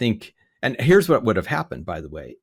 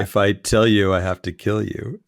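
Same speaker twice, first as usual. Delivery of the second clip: mock spy line; 4 semitones lower, 3 dB louder than usual. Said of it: "I was trying to kind of give him that vibe".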